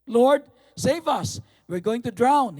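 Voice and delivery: loud, high cry for rescue; panicked plea. Acted. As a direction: call out to be saved from drowning. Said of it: "went and woke him, saying".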